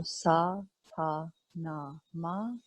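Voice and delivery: Sa Ta Na Ma slowly, evenly spaced beats; even syllables. Shown as a demonstration. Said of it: "syllables chanted in equal distance".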